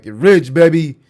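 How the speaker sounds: shouted up, get rich cheer; loud hype call. Real, not acted. Streamer hyping a viewer's shoutout, loud hype yell. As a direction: hype it up at full volume.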